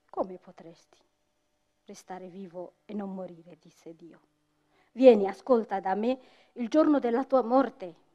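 measured storyteller's voice with music under it; story narration. Acted. Storyteller telling an audience the coffee legend, measured and solemn.

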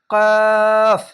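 কফ said heavy, loud and held; emphatic Arabic letter. Shown as a demonstration. Teacher demonstrating the heavy letter, loud and full. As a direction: boom out কফ with a thick sound. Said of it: "এখানেও তিন আলিফ টেনে পড়বেন".

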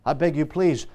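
low, hushed plea; begging. Acted. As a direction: beg quietly and earnestly. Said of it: "Jacob does the same thing with God".

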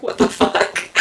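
loud, forced-whisper disbelief; freaked-out reaction. Retold or imitated. Lying next to sleep-talking friend, loud whispered disbelief. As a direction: blurt it out in a loud stage whisper.

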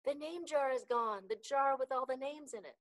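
high, very loud alarm; alarmed storybook shout. A narrator's reading. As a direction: shout the news across the classroom. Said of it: "Ralph shouted at him".

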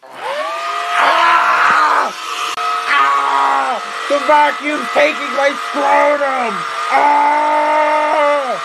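high shrieking screams in panic; screaming. Acted. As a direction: scream as if being hurt. Vacuum attacking, screaming.

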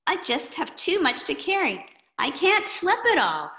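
put-on a kid complaining he carries too much; overloaded kid. Schleppy Boy complaining.

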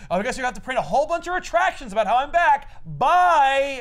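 high, loud cocky taunt; mock gloating. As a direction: rub it in and leave. Spider-Man gloating exit.

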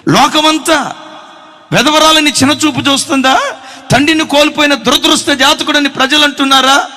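fervent sermon questioning; preaching questions. Acted. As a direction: challenge the congregation.

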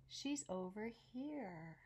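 ordinary delivery, nothing marked

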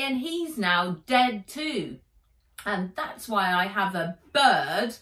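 furious, near tears, loud; bubbling with anger. A narrator's reading. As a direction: let the hurt spill over as anger. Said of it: "She was bubbling over with anger".

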